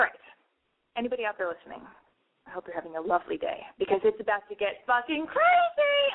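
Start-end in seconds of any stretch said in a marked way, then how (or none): 4.9-6.0 hyped-up. Pretend. Hyping up the show's start.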